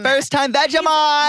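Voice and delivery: high, loud, drawn-out cheer; hammed-up announcement; mock excitement. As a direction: shout it like a hyped-up co-host. Joins the pretend show intro.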